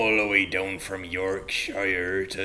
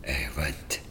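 tiny whispered voice; a shy creature whispering its name; whispered character; 10 semitones lower, 9 dB quieter than usual. Pretend.

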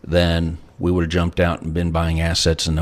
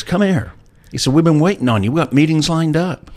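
much higher, lively retold friend; Christos eager; 10 semitones higher than usual. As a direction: mimic the friend waving you over, keen. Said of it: "he says".

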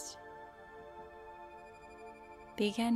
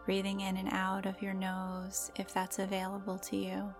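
ordinary delivery

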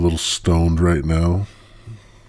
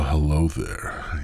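ordinary talk, nothing marked

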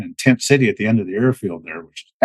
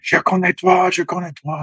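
excited recognition; 9 semitones higher than usual. Retold or imitated. French colonel recognising him, retold in French.

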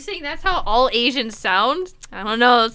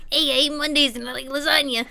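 Garfield voice; 4 semitones higher than usual. Pretend. Garfield impression.

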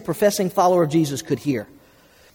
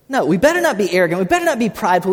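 ordinary talk

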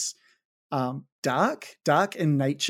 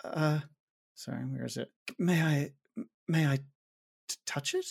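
much softer, hesitant asking; timid request; 8 dB quieter than usual. Acted. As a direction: ask shyly, barely voiced. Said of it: "Irene is like".

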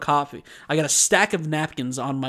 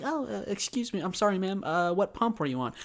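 mock polite clerk; 6 semitones higher, 4 dB quieter than usual. Retold polite clerk talking to customer.

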